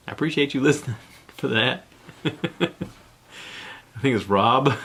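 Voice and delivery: chuckling through the words; laughing thanks. Real, not acted. Host thanking a listener who wrote in, laughing. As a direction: break into giggles while giving thanks.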